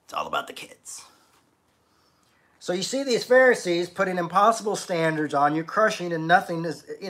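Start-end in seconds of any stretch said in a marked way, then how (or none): 0.0-1.1 tired whispered aside. Retold or imitated. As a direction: breathe it out like a weary secret. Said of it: "you just get tired and you think".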